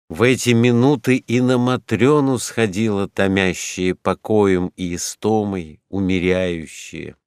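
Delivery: ordinary delivery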